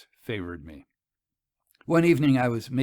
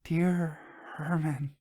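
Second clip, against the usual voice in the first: breathed; 4 semitones higher, slower than usual. A narrator's reading. Selenitha accepting Hermann, slow and breathed. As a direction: breathe it rather than speak it. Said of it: "breathed rather than spoke".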